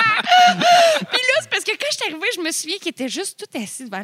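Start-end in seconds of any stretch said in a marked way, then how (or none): 0.1-1.4 squealing high laugh. Real, not acted. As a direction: burst into a shrieking laugh. Bursts out laughing at own story.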